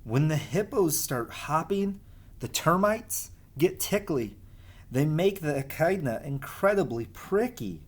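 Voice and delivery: lively sing-song storybook reading; reading to children. Acted. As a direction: read the rhymes as if to a class of kids. Reads rhyming picture book aloud.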